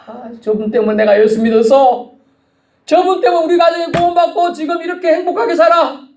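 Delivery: high, sustained proclaiming quote; exalting testimony. Acted. Mourners crediting the deceased for their faith, exalting testimony. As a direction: voice the grateful testimony, pitched right up.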